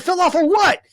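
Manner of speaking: very high, incredulous holler; loud re-enacted yell; retold yell. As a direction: shout back up, totally baffled. Acted shouted exchange.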